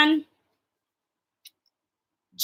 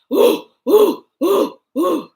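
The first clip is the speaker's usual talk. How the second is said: daddy monkey; 3 semitones higher, 3 dB louder, slower than usual. Shown as a demonstration. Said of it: "Daddy monkey".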